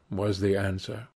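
ordinary delivery, nothing marked

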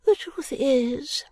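put-on high, slow fussy old lady; an old woman about to confide; fluttery old lady. Miss Flite begins a confession, high and slow.

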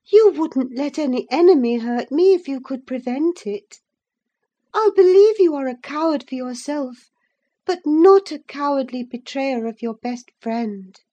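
high, anxious coaxing plea; moved and alarmed. A narrator's reading. Catherine gently pressing Linton to confess. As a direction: coax the truth out of him tenderly. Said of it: "thoroughly moved and alarmed".

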